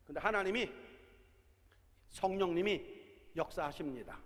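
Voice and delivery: usual speaking voice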